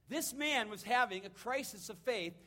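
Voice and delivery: ordinary talk, nothing marked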